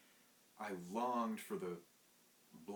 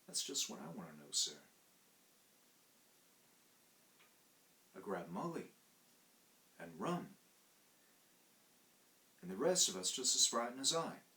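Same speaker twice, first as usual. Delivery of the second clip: a hint of an uneasy Cockney manservant; embarrassed Cockney servant. Thomas admitting to his master that he ran away, uneasy. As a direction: confess it reluctantly.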